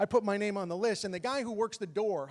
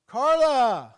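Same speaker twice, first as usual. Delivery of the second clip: loud, high drawn-out holler; yelled call; 5 semitones higher, 11 dB louder than usual. Retold or imitated. Failing comic calls to his girlfriend at the bar, yelled. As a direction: shout the name out across a room. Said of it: "he yells out".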